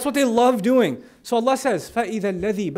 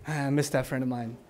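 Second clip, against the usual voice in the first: low, soft, wistful sigh of a line; a low hushed ache of missing a friend; fond longing; 11 semitones lower, 10 dB quieter than usual. Pretend. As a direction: sigh it like you truly miss them.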